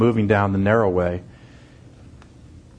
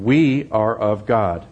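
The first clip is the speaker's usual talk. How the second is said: "We" weighted, slow and deliberate; slow down and lean on "We"; stress on WE; 2 semitones lower, slower than usual. Shown as a demonstration. Preacher reading verse six, slowly stressing "We". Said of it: "emphatic position".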